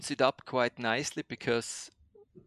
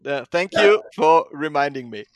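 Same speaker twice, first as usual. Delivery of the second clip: normal talking voice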